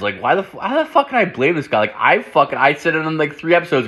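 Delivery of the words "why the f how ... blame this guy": high, fast disbelieving burst; incredulous outburst. Real, not acted. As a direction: catch yourself, pitch jumps up and quick. Sudden self-realising outburst.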